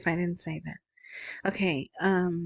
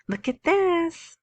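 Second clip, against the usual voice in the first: much higher, slow "look at this"; amazed; 10 semitones higher, slower than usual. Real, not acted. Host showing listeners a striking headline, amazed. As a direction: draw listeners in with wonder.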